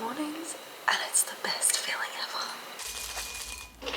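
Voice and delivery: soft hushed whisper, almost no voice; morning whisper. Real, not acted. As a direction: whisper so you don't wake anyone. Whispers morning vlog update.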